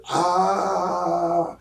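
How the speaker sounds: high mock yell of pain; imitated scream in a fight; retold scream.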